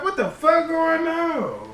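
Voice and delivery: high, hushed, slowed disbelief; stunned whisper-like. Retold or imitated. Man at his bedroom door, hushed and shocked. Hears porn behind closed door.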